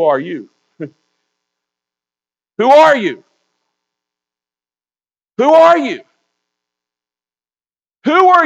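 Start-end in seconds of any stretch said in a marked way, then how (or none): 2.6-3.2 loud, high preacher's demand; raised voice, falling sharply at the end; forceful challenge. Acted. Verse repeated as forceful challenge. 5.4-6.0 loud, high preacher's demand; raised voice, falling sharply at the end; forceful challenge. Acted. Verse repeated as forceful challenge.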